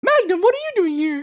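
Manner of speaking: put-on very high old lady; Angela Lansbury voice. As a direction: squeak it up like Jessica Fletcher. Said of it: "Angela Lansbury's voice".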